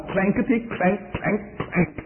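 soft imitated clank of armor. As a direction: mimic the armor clanking quietly. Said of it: "the clanking armor".

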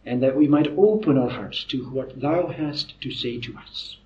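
low, soft, reverent prayer with pauses. Real, not acted. Preacher asking God to guide them tonight, hushed prayer. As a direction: pray softly, pausing between phrases.

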